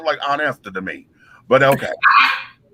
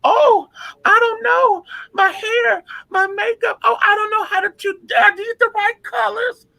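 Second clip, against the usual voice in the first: mock helpless diva. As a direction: play dumb and helpless in a high voice. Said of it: "acting as if she didn't know".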